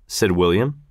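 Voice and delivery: usual speaking voice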